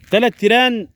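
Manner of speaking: high, loud burst on the bulls; excited emphasis. A narrator's reading. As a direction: announce it with a dramatic lift. Emphatic narrator exclamation.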